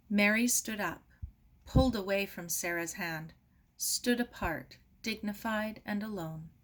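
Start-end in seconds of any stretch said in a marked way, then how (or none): none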